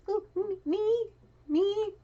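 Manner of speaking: tiny, very high, drawn-out lamb voice; innocent lamb. Retold or imitated. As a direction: pipe up meekly like a little lamb. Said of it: "being a lamb and saying".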